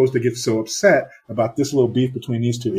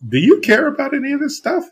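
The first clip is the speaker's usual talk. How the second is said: much higher mock producer voice; imitating producer; 4 dB louder than usual. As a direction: squeak out the producer's question, mocking. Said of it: "he's like".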